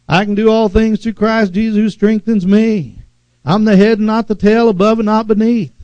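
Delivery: quoting scripture with conviction; confessing scripture. Acted. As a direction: declare the promises boldly.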